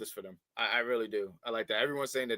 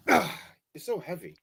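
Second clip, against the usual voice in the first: ordinary delivery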